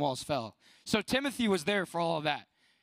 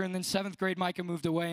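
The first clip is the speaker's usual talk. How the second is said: ordinary delivery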